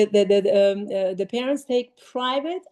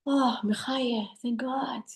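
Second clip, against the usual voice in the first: slow relieved aside trailing to a whisper; quiet thank God; relieved sigh; 5 dB quieter, slower than usual. Real, not acted.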